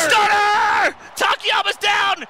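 high, drawn-out shouted call; thrilled wrestling commentator yell; excited play-by-play. Real, not acted. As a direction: shout the finisher as it hits. Calling a big finishing move.

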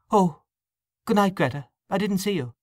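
high-pitched, lightly surprised greeting; mild surprise. A narrator's reading. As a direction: greet as if just noticing someone. Belatedly notices Greta at parting.

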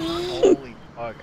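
yelling. Shown as a demonstration. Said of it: "if I was yelling".

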